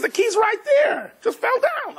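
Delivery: very high acted yell; exasperated retort. Wife showing husband the key is there, exasperated high yell. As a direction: shoot back in a squeal of protest.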